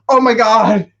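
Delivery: mock fangirl awe; starstruck fan. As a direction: imitate an amazed fan, drawing out the words. Acted excited fan meeting him.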